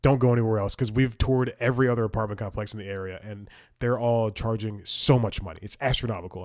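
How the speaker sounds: ordinary delivery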